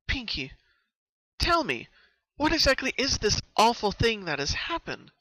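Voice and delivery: steady her and ask; urgent reassurance. Acted. Twilight pressing Pinkie to explain, high urgent voice. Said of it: "tried to reassure Pinkie".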